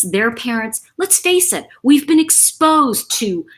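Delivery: ordinary talk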